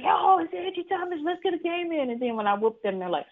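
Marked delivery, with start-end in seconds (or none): 0.0-2.1 high, bright-eyed boast of a challenge; cocky gamer challenge. Retold or imitated. To online gamers, a cocky higher-pitched challenge. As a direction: call them out to play, much higher.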